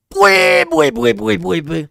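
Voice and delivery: shrieking, very high and loud; One Piece laugh. Retold or imitated. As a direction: shriek it like an anime villain. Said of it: "That's also a One Piece laugh".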